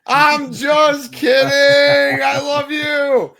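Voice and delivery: high, drawn-out loud holler; joking shout. Real, not acted. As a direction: yell it playfully, stretching every word. Mock insult, then shouted retraction.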